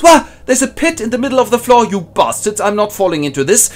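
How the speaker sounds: high, loud cartoon yelp and cursing; startled prisoner. Prisoner stumbling at the pit's edge, high loud cartoon cursing. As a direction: shriek at the pit, then curse the torturers. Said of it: "he stumbles and goes".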